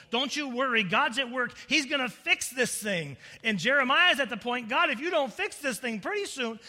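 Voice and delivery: loud, high, driving sermon voice; full-force preaching. Real, not acted. Preacher exposes what false prophets told people, at full force. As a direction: drive it home to the congregation.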